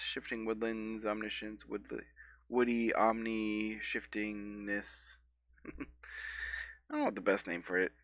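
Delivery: ordinary talk, nothing marked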